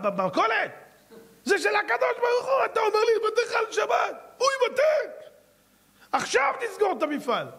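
high, shouted outraged rebuke; the weeping rabbi shouts his rebuke at the owner; anguished shout. Acted. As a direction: cry out in pained outrage.

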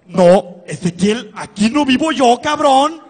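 loud indignant protest. Retold or imitated. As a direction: object loudly to the ex. Protesting arrival at motel.

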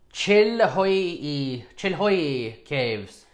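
normal talking voice

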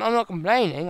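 ordinary talk, nothing marked

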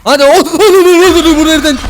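loud rising panic yell; panicked shout. Real, not acted. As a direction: yell in alarm as it appears. Panicked gamer shouting at enemy. (panikle bağırma)

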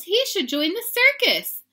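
very high, loud excitement; gushing. A narrator's reading. Girls cheer their brother's tricks, gushing high. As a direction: gush it out like delighted little girls. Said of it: "gushed the girls".

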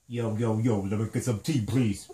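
hammed-up low gangsta swagger. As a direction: drop the voice low and play a tough guy ordering tea. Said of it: "or we can have a gangsta tea party".

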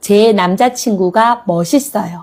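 ordinary talk

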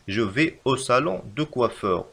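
very slow, each word separate; slow dictation. Shown as a demonstration. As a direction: dictate the sentence slowly. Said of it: "كما نرى هنا".

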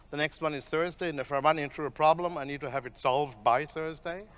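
ordinary delivery

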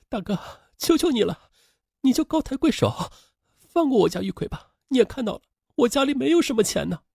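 high, soft entreaty; pleading. Acted. Sister begging the creditor to spare her brother, pleading. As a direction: beg humbly in a put-on woman's voice.

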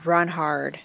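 ordinary delivery

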